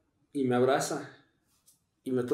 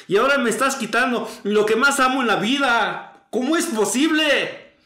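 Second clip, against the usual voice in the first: anguished cry to God, pitch raised and building louder; plead, then protest louder and louder in reenacted grief; gritos a Dios; 5 semitones higher, 3 dB louder than usual. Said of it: "empiezo a gritar". (gritando de dolor, reclamándole a Dios)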